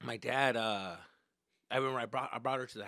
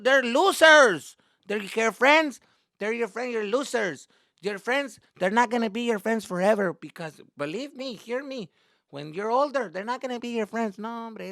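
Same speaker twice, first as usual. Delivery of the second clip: raised, loud mom voice; insistent mother; 9 semitones higher, 9 dB louder than usual. Retold or imitated. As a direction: lecture the kid as an insistent mom. Acted mother lecturing upset son.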